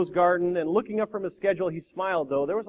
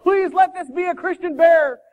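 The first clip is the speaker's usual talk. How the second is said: high, shouted plea to God; desperate loud prayer; 13 semitones higher, 9 dB louder, slower than usual. Acted. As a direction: cry out a prayer in panic. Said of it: "began to pray loudly".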